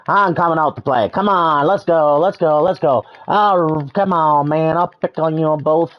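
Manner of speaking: high, loud cocky challenge; loud fight taunt. Pretend. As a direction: pump yourself up and call them out.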